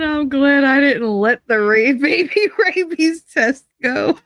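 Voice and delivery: laughing through the words; can barely get it out for laughing; giggling helplessly mid-sentence. Real, not acted. Laughing while reading a quote.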